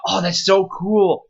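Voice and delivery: slow, high, delighted gush; gushing fan. Retold or imitated. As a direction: swoon over something cool. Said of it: "me rambling about".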